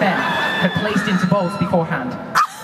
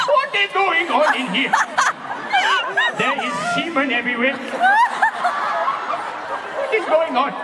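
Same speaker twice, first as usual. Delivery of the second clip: high-pitched, badly faked South African; South African accent; 14 semitones higher than usual. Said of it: "South African accents, so bear with".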